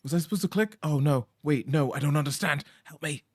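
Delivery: high, loud, flustered pleading; flustered panic. Real, not acted. Player lost in the fishing game, loud high panic. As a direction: panic as the controls baffle you.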